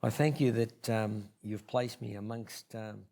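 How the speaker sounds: hushed prayer fading to almost nothing; quiet heartfelt prayer to close; closing prayer. Acted. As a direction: pray softly, letting the voice sink away.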